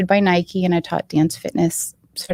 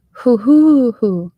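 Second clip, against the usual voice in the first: high, loud mock dove hoo; dove cooing; 6 semitones higher, 7 dB louder, slower than usual. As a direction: coo it out like a dove. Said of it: "and went".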